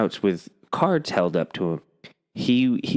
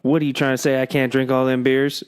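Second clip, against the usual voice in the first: put-on higher, challenging Georgia accent; 6 semitones higher than usual. Old camper boasting to a ranger about beers, Georgia accent. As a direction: push back indignantly in a Georgia accent. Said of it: "a Georgia accent".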